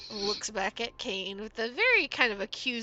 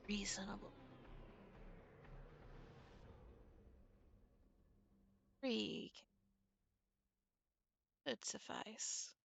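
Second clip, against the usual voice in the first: whispered, unvoiced and much softer; hushed, wary; 12 dB quieter than usual. Merchant whispering his price to Kane, hushed. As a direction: breathe the price out like a secret. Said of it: "see this little crease between his brow".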